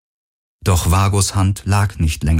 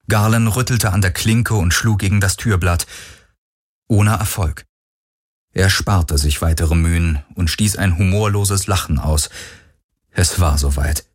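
usual speaking voice

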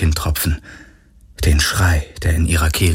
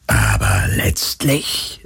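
much lower, slow, airy whisper; unconcerned; 10 semitones lower, much slower than usual. Acted. The phantom dismisses Galen's resistance, carelessly whispered. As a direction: shrug it off like a careless ghost. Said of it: "sagte das Phantom unbekümmert".

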